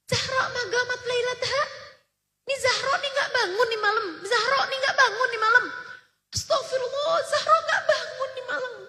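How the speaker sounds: retelling his anguish with half-laughing fondness; retold lament. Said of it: "Beliau nangis terisak-isak".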